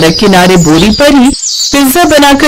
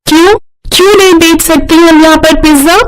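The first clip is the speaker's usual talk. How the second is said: defiant; 7 semitones higher than usual. Acted. Girl defies Madan.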